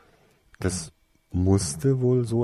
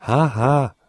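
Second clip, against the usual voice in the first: sarcastic "ha ha", loud and raised; mock laugh; 6 semitones higher, 7 dB louder than usual. A narrator's reading. Girl grumbling at his teasing, sarcastic. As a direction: bleat a fake, unamused laugh. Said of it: "meckerte sie".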